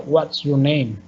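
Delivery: "What's your name?" dropping on "name"; WH-question closing downward on "name"; falling intonation. Shown as a demonstration. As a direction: let "name" go down at the end. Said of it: "van a ser bajando la entonación al final".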